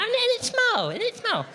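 very high, sing-song coo; cooing baby talk. Retold or imitated. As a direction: croon it like to a toddler. Cooing repetition.

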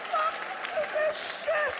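very high, drawn-out shocked squeal; shocked disbelief. Retold or imitated. Tourist staring out the van window, shocked high squeal. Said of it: "you're like".

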